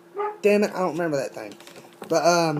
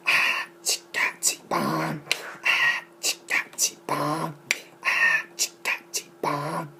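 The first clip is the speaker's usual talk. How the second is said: whispered, breath-heavy beatbox loop; rhythmic mouth sounds; 6 semitones lower, 7 dB quieter than usual. Shown as a demonstration. Showing off a made-up sound, rhythmic whispered beatbox. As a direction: chant nonsense syllables in a steady beat, mostly unvoiced. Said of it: "I'm going to do some sounds".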